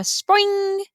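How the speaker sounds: drawn-out, high ringing spring noise; springy boing. A narrator's reading. Narrator imitates bedsprings as the dog jumps, long springy ring. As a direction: imitate a spring twanging with the word. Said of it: "there was a spring sound".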